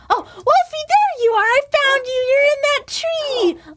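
thrilled to finally spot him; excited discovery. Acted. Player finding her pet wolf Wolfie in a tree, delighted.

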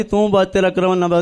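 ordinary talk

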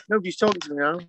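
ordinary talk, nothing marked

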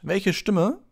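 high, loud puzzled question; baffled Lockhart. A narrator's reading. Lockhart asks Harry what he means, baffled. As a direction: caught off guard and bemused. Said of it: "sagte Lockhart verdutzt".

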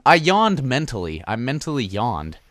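ordinary talk

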